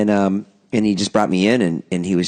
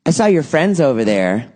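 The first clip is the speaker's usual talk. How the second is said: imitating know-it-all girl; 6 semitones higher than usual. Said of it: "And she just goes".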